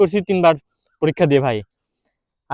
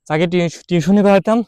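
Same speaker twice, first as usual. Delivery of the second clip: normal talking voice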